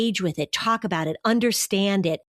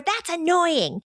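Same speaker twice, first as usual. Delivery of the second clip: put-on annoyed kid; 10 semitones higher, slower than usual.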